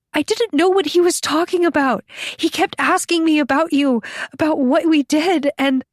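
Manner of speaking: high, pleading rush of confession; distraught outpouring. A narrator's reading. Captive tells her rescuer about being questioned, distraught and high. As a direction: pour it out on the verge of tears, pitched high.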